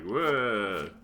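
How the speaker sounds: bad ghostly wail; hammed-up ghost moan; ghost impression. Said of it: "That's a terrible ghost impression".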